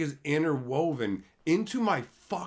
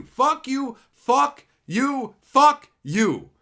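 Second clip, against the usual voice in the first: each "Fuck you" louder and higher than the last; escalating yell; 8 semitones higher, 8 dB louder, much slower than usual. Real, not acted. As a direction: build it into a full shout. Repeated curse, escalating.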